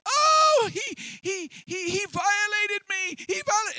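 high, loud false cry of violation; screaming accusation. Acted. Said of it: "She starts screaming".

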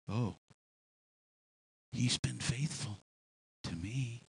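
low, soft, slow sincere reply; soft, low devout answer; quiet faith. Acted. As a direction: say it gently, trusting.

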